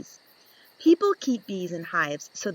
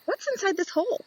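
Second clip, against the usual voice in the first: high squeaky bee voice; a tiny curious bee peeking out; little bee voice; 10 semitones higher, faster than usual. Acted. Young bee asks the reader, high and curious.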